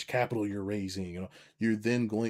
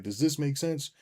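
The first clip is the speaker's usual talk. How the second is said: anxious newcomer; 6 semitones higher, 4 dB louder than usual. Retold or imitated. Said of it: "You can be like".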